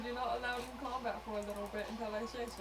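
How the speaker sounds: normal talking voice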